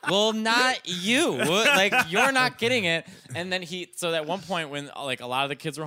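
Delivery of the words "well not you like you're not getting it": loud, high-pitched indignation; exasperated retort. Retold or imitated. Nephew refusing uncle the dead grandmother's car, exasperated. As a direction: shoot down a shameless request at full voice.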